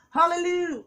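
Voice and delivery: high, loud laughing hallelujah cry; church hallelujah. Retold or imitated. Playing a churchgoer who cries out in praise, high and loud. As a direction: shout it up like a joyful congregant. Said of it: "as they say in the Baptist church".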